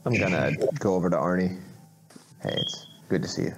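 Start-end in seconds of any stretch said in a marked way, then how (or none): 2.4-3.5 soft unvoiced greeting; whispered greeting. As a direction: say it under your breath. Quiet greeting in crowded room.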